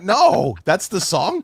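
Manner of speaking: loud high mock protest; hammed-up appalled viewer; mock outraged no. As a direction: shout it in comic disbelief. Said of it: "And I'm like".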